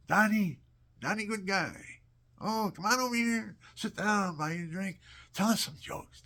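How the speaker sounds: loud, high, hearty call; bar friends calling. Retold or imitated. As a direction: holler a friend over for a drink. Bar friends calling father over.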